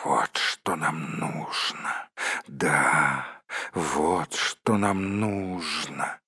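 slow, hushed, low muttering; Gollum whispering. A narrator's reading. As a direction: murmur greedily under your breath. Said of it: "прошептал Голлум себе под нос". (тихо и медленно, себе под нос)

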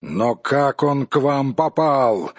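plain reading voice

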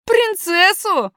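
high, loud, drawn-out surprise; stretched, incredulous question; astonished boy. Acted. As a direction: echo it slowly in high-pitched amazement. Boy astonished to meet a princess.

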